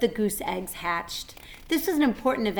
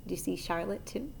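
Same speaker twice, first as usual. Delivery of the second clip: usual speaking voice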